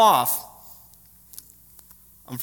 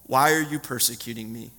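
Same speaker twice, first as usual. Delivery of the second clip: voice of Jesus; 7 semitones lower, 4 dB quieter than usual. A narrator's reading. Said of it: "I heard a voice saying to me".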